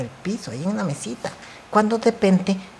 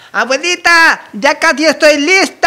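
put-on higher, louder childish call; 7 semitones higher, 10 dB louder than usual. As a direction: call to granny in another room. Child calls out to grandma.

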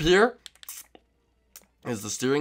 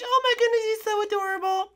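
put-on high sweet girly voice; Birdo voice; 3 dB quieter than usual. To Yoshi in the morning, high sweet Birdo voice. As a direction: pitch way up as a sweet girlfriend.

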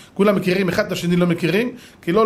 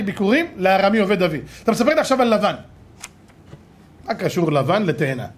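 ordinary talk, nothing marked

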